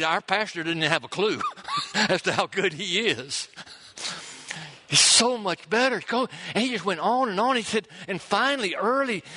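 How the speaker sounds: high, ringing preacher voice, carried away; preaching at full force. Acted. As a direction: preach the miracle with rising fervor. Preaching the miracle story.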